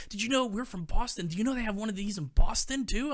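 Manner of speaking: bubbling over with discovery; excited mom. Retold or imitated. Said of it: "They're like".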